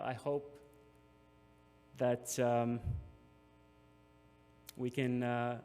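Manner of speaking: fighting back tears, words stalling; choked up. Real, not acted. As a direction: voice catching and breaking off mid-sentence. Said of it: "sorry, I'm getting emotional again".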